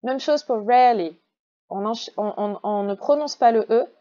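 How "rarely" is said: "rarely" in two syllables, R running into L; silent middle E. Shown as a demonstration. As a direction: link R straight to L in "rarely". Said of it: "on enchaîne le R et le L".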